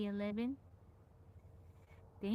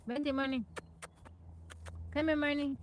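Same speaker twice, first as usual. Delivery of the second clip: louder, higher call across the room; raised voice beckoning Marnie over; 6 semitones higher, 3 dB louder than usual. Real, not acted. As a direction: call her over from a distance. Calling someone over to camera.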